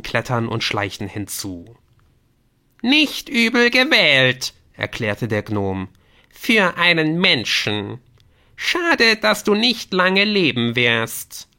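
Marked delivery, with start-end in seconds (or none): 2.8-4.5 very high, loud, stretched gnome voice; grudging praise from a squeaky gnome; condescending gnome. Acted. Gnome judges the choice. 6.3-11.5 high, loud, slow mock pity; taunting gnome.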